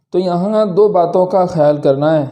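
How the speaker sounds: ordinary delivery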